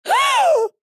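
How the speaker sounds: very high, loud "Oh!"; mock an over-the-top horrified shriek; dramatic gasped shock. Teacher walks in on the prank, dramatically shocked. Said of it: "It was so dramatic".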